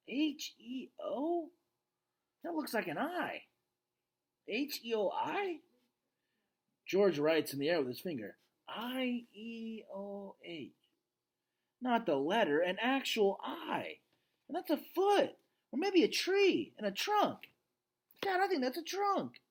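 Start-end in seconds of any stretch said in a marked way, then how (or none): 0.1-5.6 high, slow, spelling it out; puzzling girl. Acted. Julia deciphers the letters, slow and high. 8.7-19.4 put-on very high, eager young voice; rising excitement of discovery; excited girl. Julia finds letters in the paintings, excited girl voice.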